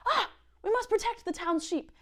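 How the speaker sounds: high, hammed-up heroic townsfolk; townspeople. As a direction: play a gallant villager rallying. Townspeople voiced in story.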